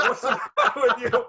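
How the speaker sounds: high, loud quote breaking into laughter; laughing retelling. Retold or imitated. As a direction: crack up while imitating dad's complaint. Cracks up retelling father's quote.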